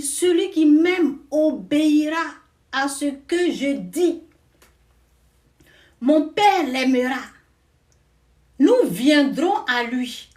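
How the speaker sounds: loud, slow, emphatic scripture reading; preaching scripture. Acted. Preacher quoting Jesus to the congregation, loud and slow. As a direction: proclaim every word like gospel truth. Reads Jesus' words forcefully.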